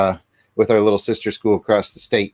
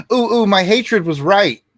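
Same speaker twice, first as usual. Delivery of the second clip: mock gloating; 9 semitones higher, 4 dB louder, slower than usual. Mocking gloating negative fans.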